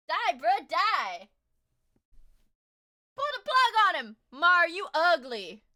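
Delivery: high, loud jeering; loud taunting. Real, not acted. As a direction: yell it like heckling. Yelling at stream vote.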